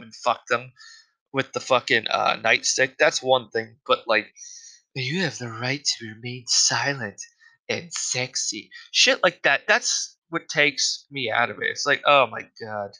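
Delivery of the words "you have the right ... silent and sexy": mock sultry cop. Mocking corny porn cop line.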